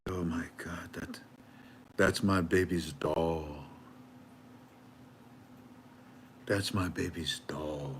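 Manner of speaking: soft breathy whisper of a stunned mother; hushed whisper. Retold or imitated. Mother realizes the fireman saved a doll, whispering. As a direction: let it drop to a whisper of shock.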